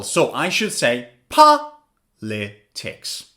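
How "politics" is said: "politics" slowly split, first syllable POL strongest; slow "politics", syllable by syllable, POL stressed. Shown as a demonstration. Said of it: "stress is on the first syllable".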